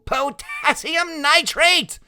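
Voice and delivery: high, loud, stretched-out cry; boy shouting in high-pitched delight; excited shout. A narrator's reading. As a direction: call it out gleefully at the fireworks. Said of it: "Freak keeps on shouting out the names".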